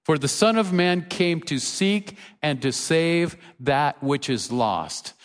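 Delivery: normal talking voice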